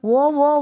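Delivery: loud, high cries of terror in a nightmare; screaming in sleep. A narrator's reading. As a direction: cry out from a bad dream. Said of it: "నిద్దట్లో గట్టిగా అరుస్తున్న". (నిద్దట్లో గట్టిగా అరుపు)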